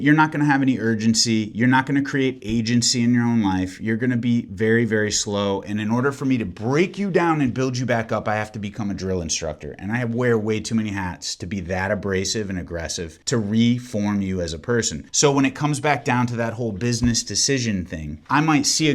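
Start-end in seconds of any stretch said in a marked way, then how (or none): none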